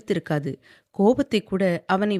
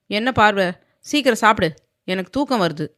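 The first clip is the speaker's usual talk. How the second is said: louder, firm and pressing; firm insistence; 2 semitones higher, 4 dB louder than usual. A narrator's reading. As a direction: push her to eat fast. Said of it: "அழுத்தமாக சொல்லவும்". (அழுத்தமாக வற்புறுத்தும் குரல்)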